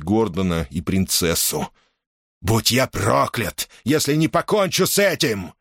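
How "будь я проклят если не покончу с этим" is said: vengeful snarl, words stretched at first. A narrator's reading. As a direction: growl the oath through rage. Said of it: "прорычал Дерк Ундис".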